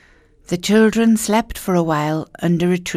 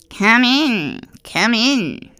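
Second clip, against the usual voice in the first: sweet, higher crone voice; old witch voice; 5 semitones higher, much slower than usual. Acted. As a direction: lure them in as a sly old witch. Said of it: "said the old woman".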